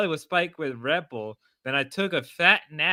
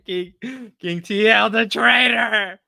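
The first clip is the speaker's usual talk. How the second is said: loud, higher call, last words drawn out; mock announcement; 6 semitones higher, 7 dB louder, slower than usual. Streamer calling out a traitor, mock announcement. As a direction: announce it big, stretching the title.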